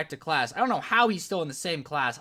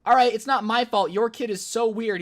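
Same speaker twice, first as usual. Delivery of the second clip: loud, high retold retort; acted-out indignant comeback; exasperated retort; 6 semitones higher, 6 dB louder than usual. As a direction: throw the blame back, fed up. Hits back at parents' blame.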